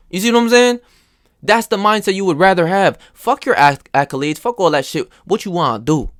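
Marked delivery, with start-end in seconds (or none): none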